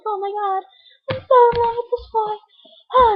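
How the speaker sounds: high-pitched lovestruck gushing; Peggy. Retold or imitated. Character gushing about a boy she loves, high and smitten. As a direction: swoon it in a high girlish squeal.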